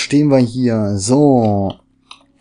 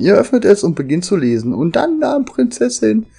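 raised, lively reading-aloud voice; story reading; 5 semitones higher than usual. Acted. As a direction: read the book text to viewers. Reads in-game book aloud.

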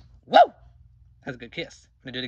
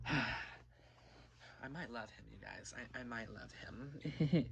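lovestruck sigh; 4 semitones lower, 15 dB quieter, much slower than usual. Acted.

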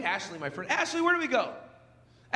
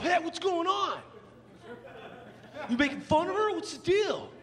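higher, half-hearted angry challenge; a lightly voiced annoyed husband confronting the comic; mock angry husband; 8 semitones higher than usual. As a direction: play the husband mildly riled up. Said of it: "he gets pissed off".

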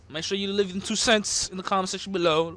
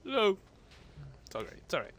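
usual speaking voice

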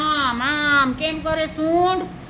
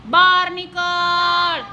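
slow, drawn-out, higher commanding call; bossy monkey minister; 4 semitones higher, much slower than usual. Acted. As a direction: stretch each word like a cheeky monkey ordering. Monkey minister orders mosquito out. (વાંદરાનો લાંબો ખેંચેલો હુકમ)

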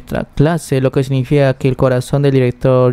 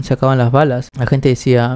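ordinary delivery

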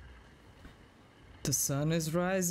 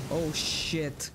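ordinary talk

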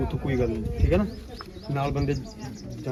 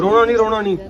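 loud, raised-pitch urging; urgent insistence; 7 semitones higher, 10 dB louder than usual. Real, not acted. Telling a tearful family not to cry, loud and insistent. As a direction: urge them firmly, high and loud.

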